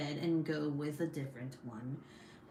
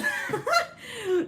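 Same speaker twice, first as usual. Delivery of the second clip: high burst of laughter; 6 dB louder than usual. Real, not acted. Caught by embarrassing comment.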